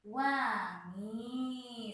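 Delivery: "wangi" drawn out very slowly, wa-ngi; slow syllable reading. Shown as a demonstration. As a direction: stretch out "wangi" syllable by syllable for children. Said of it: "Jadi bacanya wa, ... ngi". (ucapkan "wangi" sangat pelan, suku demi suku)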